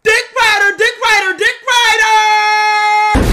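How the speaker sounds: high, loud wailing cry of the name; crying shout. Acted. Robber calls out, cries.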